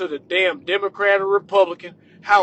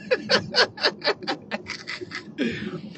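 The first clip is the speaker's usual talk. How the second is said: long breathy cackle; drawn-out staccato laugh; mocking laugh; 9 semitones higher, 7 dB quieter than usual. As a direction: laugh scornfully at the whole idea. Laughs at president voters.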